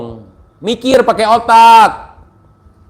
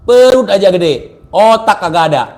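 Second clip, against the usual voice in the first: normal talking voice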